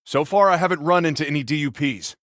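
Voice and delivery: ordinary delivery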